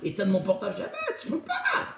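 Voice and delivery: high, rising to a squealing shout; mocking whiny excuse. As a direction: parody a whiny excuse-maker, getting shriller. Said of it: "il y a quelqu'un qui me dit". (imitation moqueuse d'un râleur, aigu et criard)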